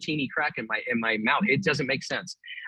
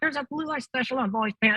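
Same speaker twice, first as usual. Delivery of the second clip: higher, imitating a store loudspeaker voice; store announcer impression; 10 semitones higher than usual. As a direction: echo the announcer lady exactly. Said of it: "And I'd be like".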